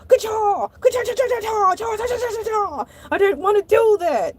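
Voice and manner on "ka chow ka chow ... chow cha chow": high karate yells; kung fu sounds. Retold or imitated. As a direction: chop the air with sound. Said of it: "my reflexes are Bruce Lee".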